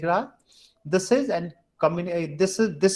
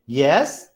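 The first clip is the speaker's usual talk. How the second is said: YES rising like a question; questioning upward YES; asking for approval; 2 semitones lower, 5 dB louder than usual. Shown as a demonstration. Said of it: "is asking for approval".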